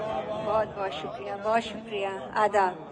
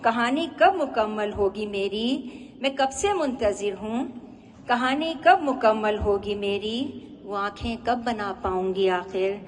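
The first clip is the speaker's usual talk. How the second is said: measured nazm recital, each phrase set apart; poetry recitation. Acted. Poet presenting a nazm about drawing eyes, poetry recitation. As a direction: recite line by line with lingering endings.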